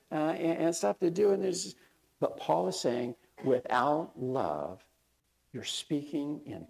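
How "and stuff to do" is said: ordinary talk, nothing marked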